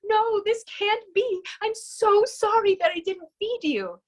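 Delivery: high, pleading apology, lightly voiced tears; Noah crying. Noah on his knees apologizing to the phoenix, mildly tearful. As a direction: beg forgiveness on your knees, a touch of sobbing. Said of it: "dropped to his knees and was crying".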